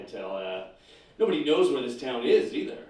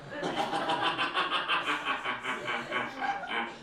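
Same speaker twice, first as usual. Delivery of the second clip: evil laugh; 3 semitones lower, 4 dB quieter than usual. Acted. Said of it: "I laugh like that now".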